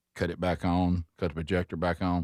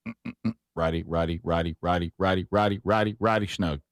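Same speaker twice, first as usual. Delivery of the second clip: tightening commentary. Retold or imitated. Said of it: "all this came with commentary".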